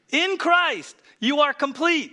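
high, loud, emphatic declaration; say it with conviction. Shown as a demonstration. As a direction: proclaim it like you mean it. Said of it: "say it like you mean it".

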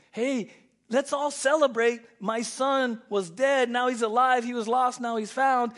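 high, loud preaching proclamation; father shouting his joy; joyful proclamation. Retold or imitated. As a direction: proclaim it to everyone. Father announcing celebration.

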